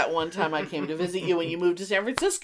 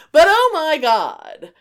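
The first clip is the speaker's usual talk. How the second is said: louder, higher, slow oh my God; exclamation; 8 semitones higher, 11 dB louder, slower than usual. Real, not acted. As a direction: belt out the exasperation. Exclaiming at loud phones.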